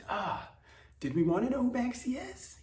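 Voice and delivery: high, put-on scoffing voice; mocking disbelief. Comic asking the news about Banksy, high mock scoff.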